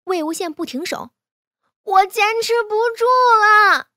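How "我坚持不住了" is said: high, loud exhausted wail; shouted high-pitched protest; desperate shout. A narrator's reading. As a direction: cry out at the end of your strength. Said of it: "金陵大喊".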